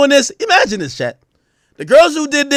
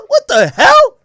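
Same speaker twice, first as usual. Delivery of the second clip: much higher squeal of disbelief; shocked squeal; 12 semitones higher, 3 dB louder than usual. Retold or imitated. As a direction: squeak it out, appalled. Mock shock at the verse.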